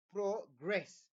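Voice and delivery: progress split in two, loud second syllable, pro-GRESS; verb stress on progress. Shown as a demonstration. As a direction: stretch and stress the end of progress. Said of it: "that is what? A verb".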